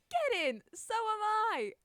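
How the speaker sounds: high, loud, whooping shout; very excited. Real, not acted. Fan learns a friend is going to the concert, excited shout. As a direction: shout it out, thrilled. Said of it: "I'm so excited".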